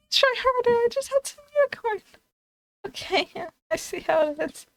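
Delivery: high-pitched, cracking-up complaint; laughing indignation. Real, not acted. Streamer rebuffed by the game over dinner, laughing in disbelief. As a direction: protest through real giggles.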